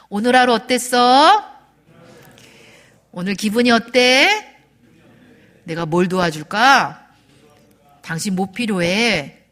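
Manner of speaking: slow, deliberate questions with long gaps; repeat-after-me model. Shown as a demonstration. Coach feeding husbands questions to ask wives, slowly modelled. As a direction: lead the group line by line, drawn out. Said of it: "남편들 따라하십니다".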